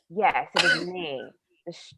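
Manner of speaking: ordinary talk, nothing marked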